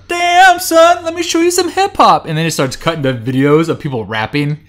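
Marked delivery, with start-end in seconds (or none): 0.1-2.3 much higher and louder mock rapper voice. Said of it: "And Snoop's like".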